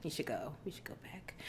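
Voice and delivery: very soft whisper; hushed aside. Real, not acted. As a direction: whisper it as if to yourself. Wistful aside about the island.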